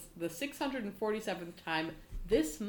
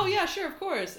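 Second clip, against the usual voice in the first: eager agreeing friend; 11 semitones higher, 4 dB louder than usual. Retold or imitated. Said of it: "And he says".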